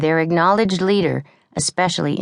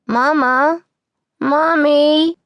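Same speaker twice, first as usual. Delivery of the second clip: put-on high, loud child's cry for mama; 12 semitones higher, 6 dB louder, much slower than usual. A narrator's reading. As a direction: wail for mama from a nightmare. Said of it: "cried Molly again".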